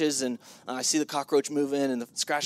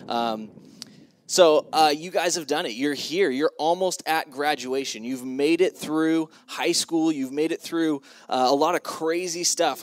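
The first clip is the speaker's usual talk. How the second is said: normal talking voice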